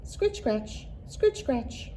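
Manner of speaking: plain reading voice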